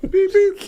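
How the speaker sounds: high, loud mock car horn beeps; mock horn honk calling him out; horn honk imitation. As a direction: toot it like a van horn. Mimicking van horn honking.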